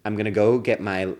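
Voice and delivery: ordinary talk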